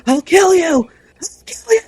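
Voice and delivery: high, loud, repeated screamed threat; mock scream. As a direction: scream it like he's thrashing in his sleep. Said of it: "screaming, "I'll kill you".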